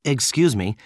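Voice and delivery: ordinary delivery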